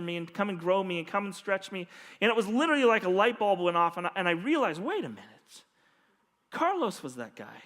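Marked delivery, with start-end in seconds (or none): 4.8-7.6 quiet realization. Retold or imitated. Pastor realizing his mentor was Carlos, hushed dawning realization. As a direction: lower and softer, dawning realization.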